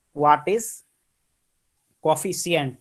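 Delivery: ordinary talk, nothing marked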